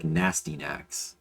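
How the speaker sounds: slow, soft Nastynax, as "NASS-tee-nax"; mispronunciation example. Shown as a demonstration. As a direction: spell out Nastynax by ear, like "nasty". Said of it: "It's definitely not Astyanax".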